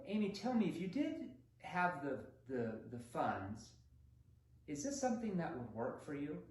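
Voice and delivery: a pause before the funds, then the question; thoughtful pause mid-question; verbal pausing. Shown as a demonstration. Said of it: "Pay attention to my tonality again".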